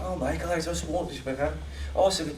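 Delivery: put-on high-pitched, garbled thick Scottish accent; Robert Carlyle off screen. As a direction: imitate an incomprehensible Scot in full flow. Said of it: "when he's off screen, he's like".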